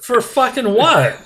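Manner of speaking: high, slow, drawn-out disbelief; incredulous. Retold or imitated. Patient told to come back, slow and incredulous. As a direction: stretch out the outrage. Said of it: "And it's like".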